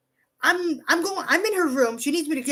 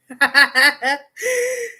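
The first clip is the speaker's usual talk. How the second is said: real uncontrolled giggling fit; hearty laugh; 2 semitones higher, 5 dB louder than usual. Real, not acted. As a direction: burst out laughing, ending in a squeal. Amused by what friend sent.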